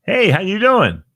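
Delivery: loud, upbeat hello to a stranger; cheery greeting. Retold or imitated. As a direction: toss out a bright passerby's greeting. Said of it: "and they go".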